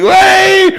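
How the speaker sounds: high, loud laughing whoop; drunken cheer. Retold or imitated. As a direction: whoop it out like a happy drunk fan.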